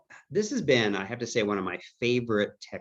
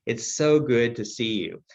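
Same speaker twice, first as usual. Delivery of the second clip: gushing, high-pitched delight, first word punched louder; hammed-up warm greeting; 8 semitones higher, 3 dB louder than usual. Son greeting his mother in memory care, hammed-up delight. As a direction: greet like an airport reunion, swooping high. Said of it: "I kind of hammed it up. I almost gave my Academy Award winning performance".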